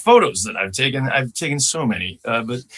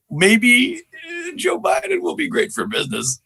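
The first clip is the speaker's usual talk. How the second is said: high, strained work-in-progress impression; Joe Biden. Comedian trying out the next president, high unfinished impression. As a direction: push the pitch way up into a presidential voice. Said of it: "still working on it".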